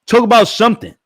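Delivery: high, loud voicing of the fans; fans' voice. Fans begging him to go live, high and loud. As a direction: squeak it out like eager fans. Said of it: "they was like".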